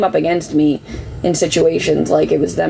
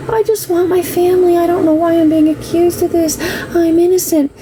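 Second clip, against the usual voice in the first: much higher, whiny plea of fake innocence; pleading ex; 10 semitones higher than usual. Imitating the ex begging his family, whiny and high. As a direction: mimic him playing the wronged victim. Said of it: "He would".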